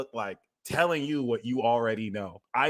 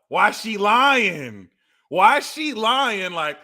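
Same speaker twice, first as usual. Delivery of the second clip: high, slow, drawn-out; accusing; 8 semitones higher, 4 dB louder, much slower than usual. Real, not acted. Calling out [name], slow and high. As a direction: drag out the accusation.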